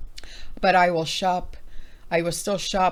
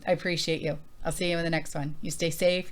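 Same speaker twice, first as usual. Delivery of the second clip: usual speaking voice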